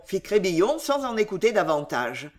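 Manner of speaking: usual speaking voice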